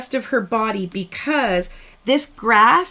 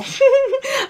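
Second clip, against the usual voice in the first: high burst of laughter; 4 dB louder than usual. Real, not acted. Laughing at her own joke.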